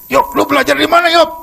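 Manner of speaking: high, loud amazed outburst; startled question. Retold or imitated. Friend is stunned the speaker ate the whole meal, high loud amazed cry. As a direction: blurt it out in shock. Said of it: "Dia kaget".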